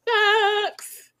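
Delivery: Facts squealed high and far; high squeaky facts. Real, not acted. Repeats after laughing.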